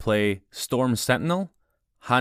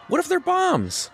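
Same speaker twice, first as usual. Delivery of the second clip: a much higher, shouted panic; panicked. Acted. Panicked question about bracelets.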